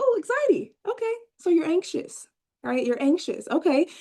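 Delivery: high, lilting "aha" to a client; high-pitched mock discovery; acted realization. Acts out her therapist realization.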